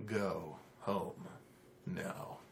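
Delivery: low, soft, curt command; cold dismissal. Acted. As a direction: dismiss him quietly and coldly. Warlock dismissing the halfling.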